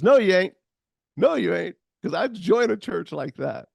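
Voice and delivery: high, loud, insistent no; emphatic denial. Retold or imitated. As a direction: push back, delighted. Diner owner protesting warmly.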